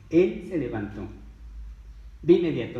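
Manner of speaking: ordinary talk, nothing marked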